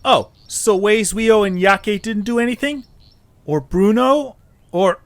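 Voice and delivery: indignant protest. Acted. Eche defends friends in quarrel.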